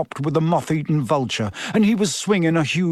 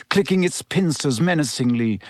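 ordinary delivery

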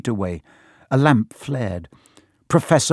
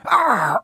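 high, sudden cry of pain; yelp of pain; 11 semitones higher than usual. A narrator's reading. Hagrid bitten on the leg, yelping. Dragon bites him on the leg.

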